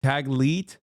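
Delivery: "Taglit" as "tag-LEET", questioning rise; tentative "Taglit?" ending like "leet"; unsure pronunciation. Real, not acted. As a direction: try out "Taglit" with a long ee ending. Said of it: "I don't know how to say it".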